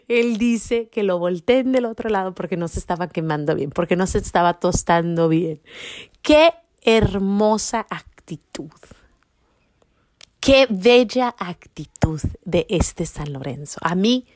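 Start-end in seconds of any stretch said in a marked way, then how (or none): none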